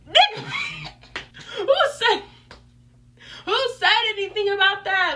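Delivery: high, loud outburst through laughter; incredulous laughing. Real, not acted. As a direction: laugh out loud in sheer disbelief. Incredulous reaction to the challenge.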